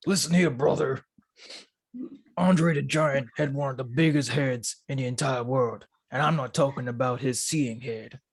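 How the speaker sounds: put-on wrestler promo voice; Hulk Hogan. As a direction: deliver a mock wrestling promo, deliberately paced. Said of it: "Hulk Hogan was".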